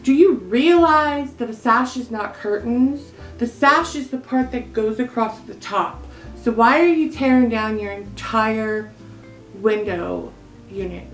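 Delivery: hammed-up indignant rant; mock scolding. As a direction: tell them off in comic exasperation. Mock scolding over the torn sash.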